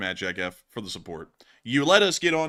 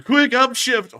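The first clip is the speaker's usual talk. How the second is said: mock shout; 12 semitones higher, 11 dB louder, slower than usual. Loud outburst inside the gag.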